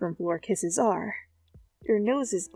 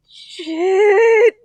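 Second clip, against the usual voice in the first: flustered curse; 11 semitones higher, 10 dB louder than usual. Acted. Zuko catching his slip.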